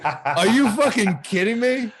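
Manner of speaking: high, slow disbelief with a laugh; incredulous. Retold or imitated. Retelling own reaction to song.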